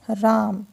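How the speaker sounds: राम said slowly with the आ held long; drawn-out vowel. Shown as a demonstration. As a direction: hold the आ in राम, slow and drawn. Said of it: "वो खींच रहा है". (राम में आ को खींचकर धीरे बोलें)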